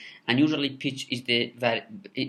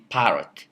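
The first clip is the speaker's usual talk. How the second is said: "parrot" with a louder PA and falling pitch; stress on first syllable; 6 dB louder than usual. Shown as a demonstration. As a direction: punch the start of "parrot", let the end drop. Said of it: "it's pronounced with pitch variation".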